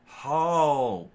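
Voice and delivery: loud, high stunned how; shocked. A narrator's reading. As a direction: blurt it in shock. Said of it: "Patty was shocked".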